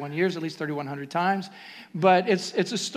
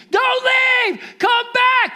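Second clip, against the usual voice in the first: put-on high shrieking child's cry; frightened child yelling for mom and dad; child screaming; 8 dB louder, much slower than usual. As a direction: scream like a scared little kid. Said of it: "I was that child ... who would scream".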